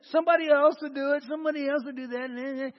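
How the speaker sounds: hurried, dismissive mock excuse; complacent brush-off. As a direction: rattle it off like passing the buck. Mimics complacent churchgoers passing the buck.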